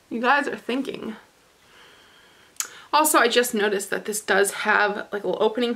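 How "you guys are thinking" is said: muffled by cloth mask; speaking behind fabric covering; through a face mask. Real, not acted.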